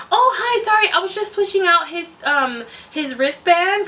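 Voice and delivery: high, chirpy imitation of a nurse; apologetic nurse. Nurse caught, apologizing, retold.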